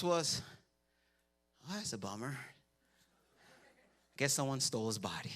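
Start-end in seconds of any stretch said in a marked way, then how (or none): 1.7-5.3 much lower and softer, unbothered; deadpan shrug. Retold or imitated. Disciples shrugging at the empty tomb, mock deadpan. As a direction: undersell it like it barely matters. Mocking disciples' flat reaction.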